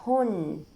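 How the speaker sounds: هن as "hun" with a held n; tanween damma. Shown as a demonstration. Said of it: "مع تنوين الضمة". (تنوين الضم)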